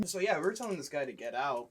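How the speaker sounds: usual speaking voice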